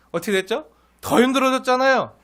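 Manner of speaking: ordinary talk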